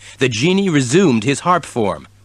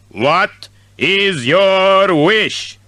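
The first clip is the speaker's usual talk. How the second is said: genie voice; 7 semitones higher, much slower than usual. A narrator's reading.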